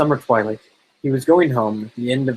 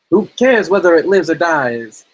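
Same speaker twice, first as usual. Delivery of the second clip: put-on high, loud taunting kid voice; older boy; 6 semitones higher, 5 dB louder, slower than usual. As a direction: egg the others on in a high boy's voice. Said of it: "One of the older boys answered".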